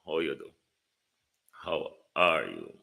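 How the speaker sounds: ordinary talk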